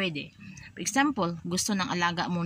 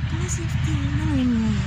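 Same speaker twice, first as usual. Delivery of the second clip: soothing hush; 8 semitones higher, 5 dB louder than usual. Shown as a demonstration. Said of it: "Ang parang ginaano mo".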